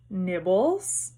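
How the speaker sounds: lightly voiced sugary poltergeist; a hint of mock sweetness; sweet. A narrator's reading. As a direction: offer it in a lightly sweet, sly voice. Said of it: "he said sweetly".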